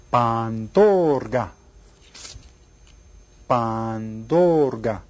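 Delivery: very slow, drawn-out "Pandorga"; syllable by syllable. Shown as a demonstration. Teacher has class count syllables, "Pandorga" very slowly drawn out. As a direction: stretch each syllable of "Pandorga", swelling on "dor". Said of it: "How many syllables?".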